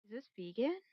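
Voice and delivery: soft suspicious question; hushed, doubtful ask; quiet. Acted. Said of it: "is like".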